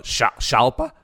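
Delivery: SHALPA begun with SH, as a question; SH pronunciation guess. Shown as a demonstration. Host guessing from co-host's X hint, questioning Shalpa with SH. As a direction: try "Shalpa" tentatively with a SH onset. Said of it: "Yeah, I don't know".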